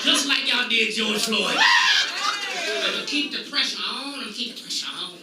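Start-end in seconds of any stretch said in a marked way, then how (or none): 1.6-4.1 preacher's shout. Acted. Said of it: "repeats the line quietly". 4.1-5.1 low, soft repeat of the line; quiet echo. Acted. Comic to the crowd after shouting, a soft low echo. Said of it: "the shouted take before".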